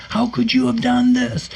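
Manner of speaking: very high, strained mock scream; high wailing protest, acted out; retold fury. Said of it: "'Cause I'm so mad".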